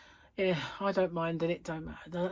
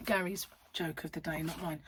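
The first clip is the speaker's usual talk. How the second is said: normal talking voice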